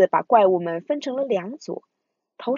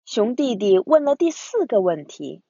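usual speaking voice

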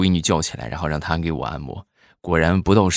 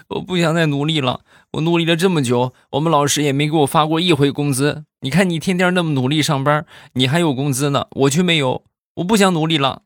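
mock whiny child, higher and tearful; a kid half-crying about never getting paid; tearful daughter; 9 semitones higher than usual. Daughter complains to her dad in a higher, tearful whine. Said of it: "带着哭腔的就说".

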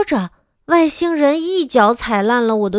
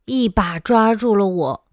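ordinary delivery, nothing marked

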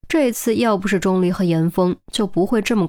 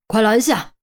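short, urgent command, mildly raised; urgent call; 3 semitones higher than usual. A narrator's reading. Director orders police to stop an attacker, urgent shout kept modest. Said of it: "赵处长大喊".

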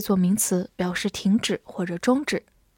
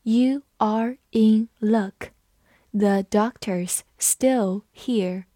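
slow, every word spaced out; slow classroom read-aloud; much slower than usual. Shown as a demonstration. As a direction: read it slowly for learners. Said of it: "我们慢读一遍".

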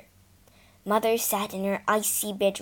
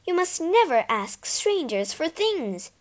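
raging; 8 semitones higher than usual. Acted. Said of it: "she raged".

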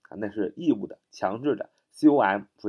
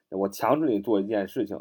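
ordinary delivery

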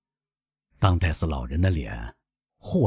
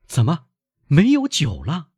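high, louder question in dismay; shocked son; 9 semitones higher, 4 dB louder than usual. Acted. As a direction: react in alarm to bad news. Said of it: "这回轮到他脸色变白".

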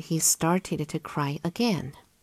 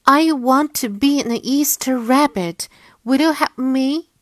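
eager little bunny voice; 7 semitones higher, 5 dB louder than usual. Acted.

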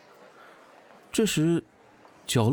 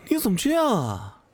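much higher, indignant rebuke; upset; 10 semitones higher than usual. A narrator's reading. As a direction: protest at a friend's cruelty. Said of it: "我有些生气".

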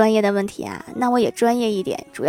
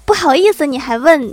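loud, high furious shout; yelling girl; 4 semitones higher, 7 dB louder than usual. Retold or imitated. Girl yells at a stranger asking the way, loud high shout. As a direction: bellow it right back at him, much higher. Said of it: "那个妹子大吼道".

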